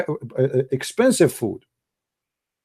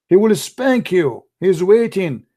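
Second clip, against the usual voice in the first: normal talking voice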